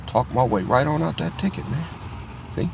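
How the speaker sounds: ordinary talk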